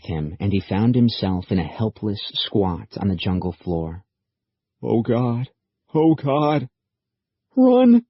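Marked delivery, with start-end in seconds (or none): 4.8-6.7 panicked. A narrator's reading. Kelly panics before the creatures. 7.6-8.0 loud, high yell of Run!; frantic yell at the creatures; desperate shout. A narrator's reading. Kelly yells at the creatures.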